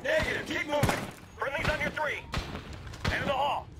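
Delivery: tense, high-pitched tactical calls; urgent combat callouts. Acted. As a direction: call it out through the noise of a firefight. Room clearing in firefight.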